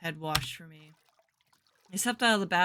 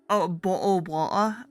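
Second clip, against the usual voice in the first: loud, hammed-up British accent; British accent attempt; 11 dB louder, slower than usual. Shown as a demonstration. Player testing voices for the nuns, attempted British accent. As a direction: have a rough go at a British accent. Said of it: "if I can do a British accent".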